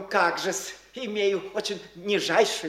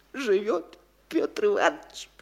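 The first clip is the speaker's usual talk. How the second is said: normal talking voice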